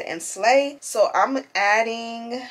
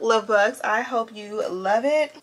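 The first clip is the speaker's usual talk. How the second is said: normal talking voice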